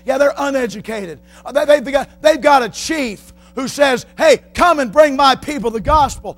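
high, loud pulpit voice; impassioned preacher's shout; fiery preaching. Acted. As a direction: thunder the point home. Preaching at full force.

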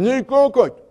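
lightly voiced mumbling sailor, name slurred; mumbled name. Preacher imitating Carl mumbling his name. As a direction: blur the name like a speech impediment. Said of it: "had a horrible speech impediment".

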